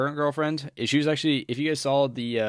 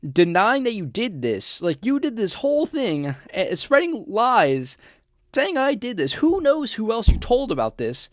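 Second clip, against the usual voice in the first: louder and higher, incredulous outburst; exasperated rant; 8 semitones higher, 7 dB louder than usual. Real, not acted. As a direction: vent at her in disbelief, much louder.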